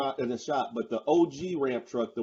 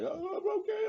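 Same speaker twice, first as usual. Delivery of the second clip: very high, soft little voice; imitated unworried driver; 5 dB quieter than usual. Passenger imitates the driver brushing him off, high soft mimicry.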